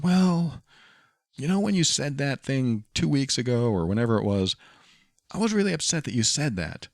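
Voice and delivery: mock girlfriend voice. Girlfriend's voice in retold argument.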